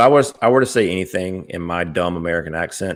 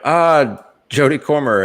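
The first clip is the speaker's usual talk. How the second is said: quoting Deadpool; 8 semitones higher, 4 dB louder than usual. Retold or imitated. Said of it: "when they were like".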